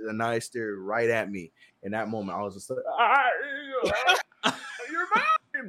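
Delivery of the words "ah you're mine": high, laughing coo to a newborn; delighted whisper-laugh to the baby; tender laughing. Retold or imitated. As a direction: melt into a laugh over her. Dad holding newborn daughter.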